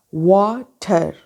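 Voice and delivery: वाटर split slowly as वा, टर; वाटर very slow, with a long AA then TER; syllable-by-syllable. Shown as a demonstration. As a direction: say वाटर in two separated beats, drawing out the first. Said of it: "एक आ sound".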